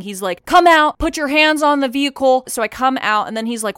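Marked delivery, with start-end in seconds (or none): 0.4-2.4 high, loud mock megaphone orders; cop on loudspeaker. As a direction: imitate police commands over a car speaker. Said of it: "With his, like, speaker thing".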